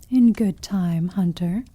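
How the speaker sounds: normal talking voice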